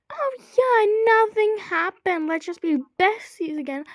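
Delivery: high-pitched fake-bestie gushing; mock enthusiasm. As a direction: gush sarcastically as if all were forgiven. Mocks pretending all is fine.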